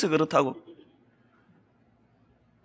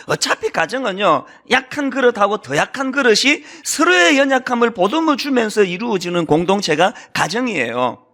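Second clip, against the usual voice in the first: normal talking voice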